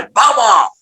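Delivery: high, strained cry of Baba; crying out Baba as the truck hits; retold scream. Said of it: "चीख निकली".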